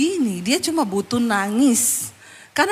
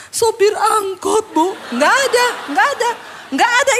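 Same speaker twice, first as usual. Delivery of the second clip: mock tearful complaint; 9 semitones higher, 3 dB louder than usual. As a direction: parody a sobbing woman naming her grievance.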